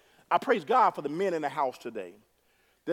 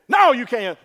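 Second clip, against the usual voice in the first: sharp loud parental no; stern parent no; 7 semitones higher, 13 dB louder than usual. Acted. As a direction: shut the child down firmly. Parent scolding a child.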